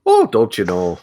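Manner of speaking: folksy Minnesota don't-you-know; sing-song upper Midwest lilt; a friendly Minnesotan; Minnesota accent. Pretend. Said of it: "being from Minnesota".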